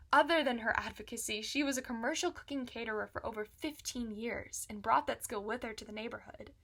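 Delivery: usual speaking voice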